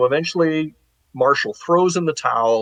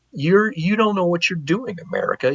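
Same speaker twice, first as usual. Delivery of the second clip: mock indignant Brits; 6 semitones higher than usual. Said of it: "The Brits are like".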